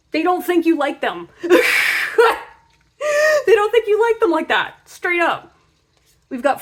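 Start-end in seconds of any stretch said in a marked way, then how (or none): 0.1-5.5 words breaking into high giggles; laughing through the line; real laughter in the voice. Real, not acted. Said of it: "laughing while speaking".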